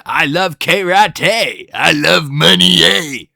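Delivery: slow, drawn-out greedy pirate lilt; lightly voiced Mr. Krabs. Krabs gloating about money, mild hammed-up accent. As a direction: savor each word like a greedy old sailor.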